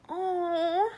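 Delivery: high, slow cooing pity; high mock-sympathetic coo; pitying sympathizer. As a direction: coo over the poor bully. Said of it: "they're like".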